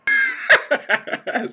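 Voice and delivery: sudden high-pitched laugh; high burst of laughter. Real, not acted. Friend laughing hard at the super head story. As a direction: laugh out loud at the joke. Listener cracks up.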